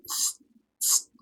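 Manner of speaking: a soft hissing tss, like a flame going out; candle snuffing hiss. Retold or imitated. Snuffing out a candle, a quiet hiss. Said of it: "And we go".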